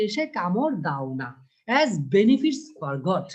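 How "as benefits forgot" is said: slow, louder declaiming; poem recital. Shown as a demonstration. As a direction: stretch each word of the verse. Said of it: "বলা হচ্ছে কি".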